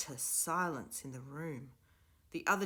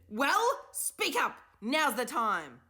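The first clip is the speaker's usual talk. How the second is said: demanding; 9 semitones higher, 10 dB louder, much slower than usual. A narrator's reading. Professor keeps demanding answers.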